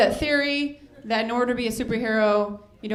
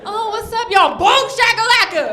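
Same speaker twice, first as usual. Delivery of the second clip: higher, louder mock hype call; hammed-up announcer; 9 semitones higher, 8 dB louder than usual. Comic mocking basketball hype, announcer shout. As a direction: bellow it like a video game announcer. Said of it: "I'm like".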